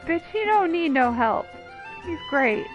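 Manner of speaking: ordinary delivery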